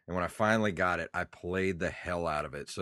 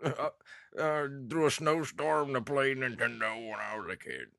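put-on old man voice; 7 semitones higher than usual. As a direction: spin it like a grumpy grandpa. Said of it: "I sound like an old man now".

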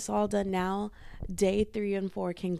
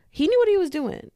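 high and loud, hyped up; fangirling burst; excited praise; 10 semitones higher, 7 dB louder than usual. Real, not acted. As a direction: gush with excitement. Excited praise of [name].

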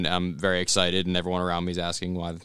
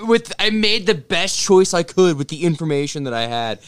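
higher, insistent self-justification; acted defensive protest; 7 semitones higher, 4 dB louder than usual. Son justifying leaving early to disappointed dad, defensive protest. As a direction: plead your case, pitch raised.

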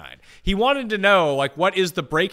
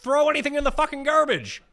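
angry; 10 semitones higher than usual. Real, not acted. Heated quarrel about garbage.

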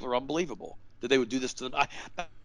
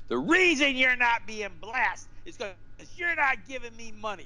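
mock screaming preacher; 8 semitones higher than usual. Preacher screaming at TV viewers about giving, mock scream. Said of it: "he started screaming at the T V".

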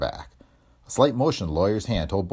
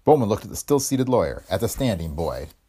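ordinary delivery, nothing marked

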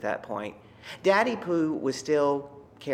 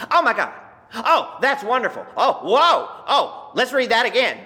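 mock ecstatic exclamations, much louder and higher; imitating ecstatic reader; 9 semitones higher, 10 dB louder than usual. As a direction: ham up a reader moaning with delight. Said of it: "It sounded like he was having sex".

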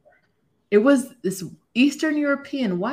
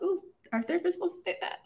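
high, fast, shocked squeal; shocked disbelief; 12 semitones higher, 5 dB quieter, much faster than usual. Retold or imitated. Retelling her shock at her therapist, a high fast squeal. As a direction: gasp at the nerve of it.

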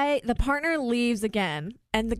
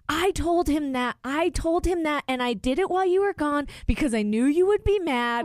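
higher-pitched, imitated defensive protest; retold quarrel; 5 semitones higher than usual. Diner defending himself to partner, imitated heated protest. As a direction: mimic someone arguing back, pitch raised. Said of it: "they got in a fight".

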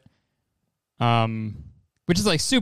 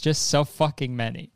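ordinary delivery

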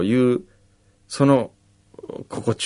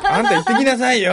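high, fast, laughing gravelly bossy voice; 12 semitones higher, faster than usual. Retold or imitated. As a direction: bark it out in a rough gravelly voice. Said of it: "そんなダミ声で言ってない".

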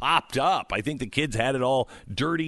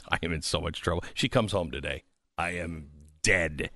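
mock dread; 7 semitones lower, 5 dB quieter than usual. Real, not acted. Mock dread of wife's return.

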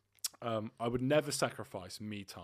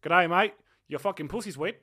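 loud, higher hammed-up Australian accent; 5 semitones higher, 10 dB louder than usual. Boyfriend hams up an Aussie accent for his girlfriend. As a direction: play the Aussie bloke, loud and cheeky. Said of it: "Yeah, do the accent".

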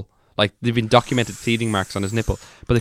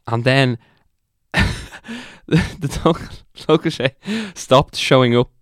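trying not to laugh; 4 semitones higher, 4 dB louder, slower than usual. Real, not acted. Giggling through bizarre story.

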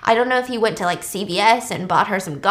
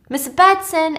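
raised, loud retold call; 7 semitones higher, 6 dB louder, slower than usual. Virgil calling Claire after only silence, raised voice. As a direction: call out for someone who hasn't replied.

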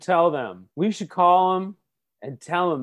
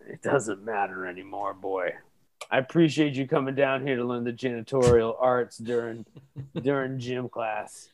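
put-on weary old janitor; 6 semitones lower, 4 dB quieter than usual. Plays old janitor to boy.

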